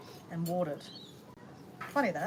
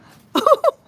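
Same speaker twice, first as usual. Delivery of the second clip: high laugh; 16 dB louder than usual. Real, not acted. Laughs after calling chickens.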